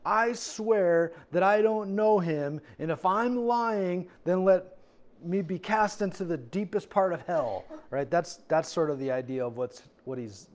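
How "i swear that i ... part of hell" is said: emphatic sworn oath, slow and raised; acted vehement denial, higher at first; calling down curses. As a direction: swear it solemnly, drawing out the words. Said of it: "calling down curses upon himself".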